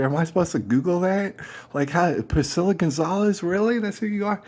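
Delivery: high-pitched incredulous questioning; sarcastic disbelief. Retold or imitated. To stranger offering her name as proof, sarcastic.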